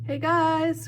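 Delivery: high, loud call; bright-pitched far-carrying yell; yelled from above. A narrator's reading. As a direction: call down to friends from overhead. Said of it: "Grover yelled somewhere above us".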